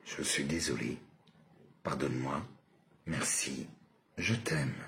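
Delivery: low, soft, separate phrases; quiet version. Shown as a demonstration. Demonstrating the mantra to viewers, quietly. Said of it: "je vous le fais, euh, sous plusieurs versions".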